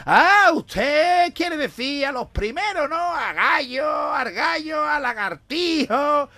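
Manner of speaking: grita alto y agudo, muy lento; aficionado a voces. Acted. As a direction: vocea los nombres de los toreros. (aficionado gritando al guiri, exasperado)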